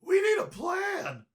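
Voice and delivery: high, loud, panicked shout. Acted. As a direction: yell it in alarm. Panicked kids under attack.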